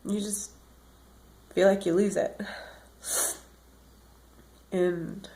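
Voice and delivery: fading, worn-out murmur; exhausted. Real, not acted. Telling viewers how the disease wears her out, exhausted. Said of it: "I get exhausted".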